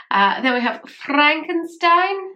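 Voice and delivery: "Frankenstein" with a rolled R, said slowly; rolled R's. Real, not acted. Naming a palette shade, "Frankenstein" with a rolled R. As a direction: roll the R in "Frankenstein", drawn out. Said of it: "Don't know why I'm rolling my R's".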